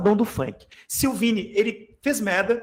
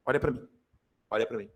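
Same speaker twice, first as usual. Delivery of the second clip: much lower and softer, almost hushed; 8 semitones lower, 9 dB quieter, faster than usual. Shown as a demonstration. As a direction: drop to a hushed plea for attention. Teacher repeats, dropping voice. (em voz baixa e abafada)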